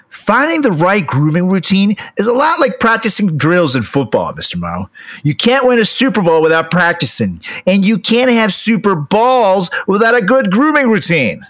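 upbeat sponsor read, sports-announcer energy. Acted. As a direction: sell it like a game-day hype reel.

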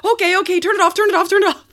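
high, loud panicked yelling; panicked shout. Retold or imitated. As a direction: shout it in a rush. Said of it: "I was like".

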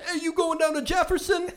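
high hollering mock drunk guy; imitating a drunk man yelling from the train door.

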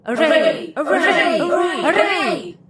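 high ringing war cry with echoing voices; thunderous battle cry. A narrator's reading. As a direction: cry it out high, drawing out the last one. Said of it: "a shout louder than a thunder peal crying".

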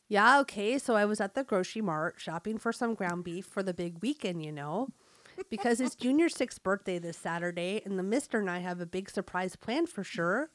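chatty Fargo-style North Dakota mom; North Dakota accent. Acted. Mom chatting about Junior's birthday, North Dakota accent. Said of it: "read with a North Dakota accent".

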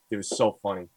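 ordinary delivery

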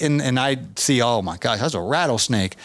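ordinary talk

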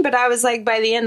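usual speaking voice

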